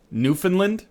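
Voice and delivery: "Newfoundland" squeezed short, unstressed middle, rising in surprise; questioning local pronunciation. Shown as a demonstration. Questioning a local's "Newfoundland", reduced middle syllable. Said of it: "That's how we pronounce it up here".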